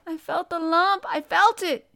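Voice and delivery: high, loud, distraught wail; choked out. A narrator's reading. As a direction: wail it as if choking on tears. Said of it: "choked out Colin".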